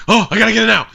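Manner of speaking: high, loud eager voice; eager shopper. Retold or imitated. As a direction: play a hyped buyer who can't wait. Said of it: "they like the idea that people are like".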